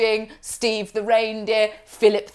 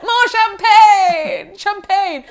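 high, drawn-out shout for more; mock champagne demand; 12 semitones higher, 6 dB louder, much slower than usual. Pretend diner calls for more champagne, high drawn-out shout. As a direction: call out greedily, stretching the words high.

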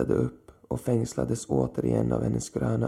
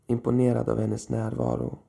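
plain reading voice

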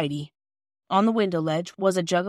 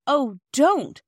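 pleading oh don't; 7 semitones higher, 6 dB louder than usual. Acted. Children begging Mrs Perks.

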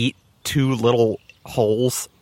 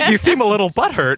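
laugh-choked teasing, higher; cracking up; 6 semitones higher, 3 dB louder than usual. Real, not acted. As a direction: squeeze the joke out through giggles. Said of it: "I'm cracking up".

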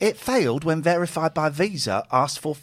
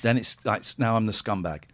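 ordinary delivery

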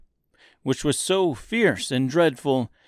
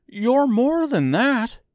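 slow, high, louder Scarecrow grief; grieved tone; 5 dB louder, slower than usual. A narrator's reading. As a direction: sound hurt and let down. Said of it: "in a grieved tone".

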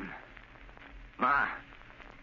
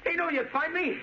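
high-pitched, mounting agitation; frenzied threat; 7 semitones higher than usual. Acted. Cornered son threatens the Shadow, frenzied. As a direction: threaten him, voice climbing.

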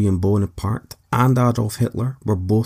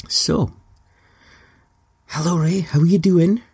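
talking to a pet; 8 semitones higher than usual. Acted. Greeting his cat for interview.

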